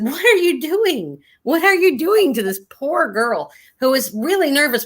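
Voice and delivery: high-pitched imitation of a reproachful educator; indignant scolding. Educator to patient in defence of a student, retold indignant scolding. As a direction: act out telling someone off in disbelief.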